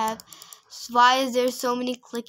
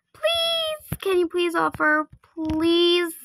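high squeaky first please, then higher begging; pleading; 6 semitones higher than usual. Real, not acted. Player begging a trader to offer, pleading. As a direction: draw out each please, imploring.

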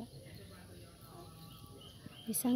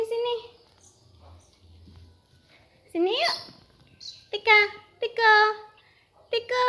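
higher, louder calling Tika; 11 semitones higher, 5 dB louder than usual. Real, not acted. Calling her squirrel Tika to come over, higher louder call. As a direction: call the pet over from across the room.

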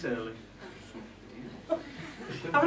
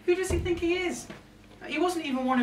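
indignant outburst; 13 semitones higher than usual. Acted. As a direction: scoff at the nerve of him. Indignant rant about Rudolph.

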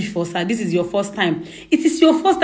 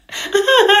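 a high laugh breaking out; helpless giggle; burst of laughter; 8 semitones higher than usual. Acted.

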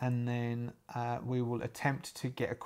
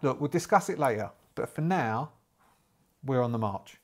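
ordinary talk

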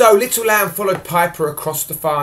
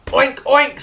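higher oink oink from the pigs; 9 semitones higher than usual. Acted. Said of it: "said the pigs".

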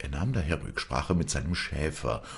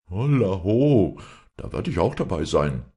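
loud call; 4 semitones higher, 6 dB louder than usual. Acted. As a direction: shout it out boldly. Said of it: "rief Gottlieb".

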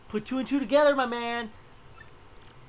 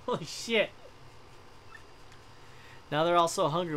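normal talking voice

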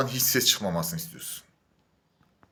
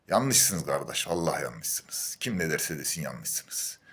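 drops to a slow, soft, low mutter; quietly shaking his head at them; low resigned mutter; 11 semitones lower, 10 dB quieter, much slower than usual. Real, not acted. To the gym complainers, slow low resigned muttering.

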